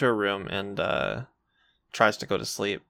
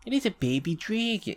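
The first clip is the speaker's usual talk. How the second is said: put-on baby dragon voice; 10 semitones higher, 3 dB quieter than usual. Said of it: "that's the reason I always say baby dragon".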